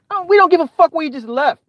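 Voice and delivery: high-pitched, incredulous retort; exasperated dismissal. Acted. Viewer answering bragging streamers, exasperated.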